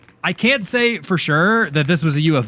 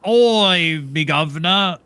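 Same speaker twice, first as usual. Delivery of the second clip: hammed-up Cockney street greeting; badly faked Welsh that comes out Cockney; mock Cockney accent; slower than usual. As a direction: call out in a caricature Cockney. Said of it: "the pair of you just did a Cockney accent".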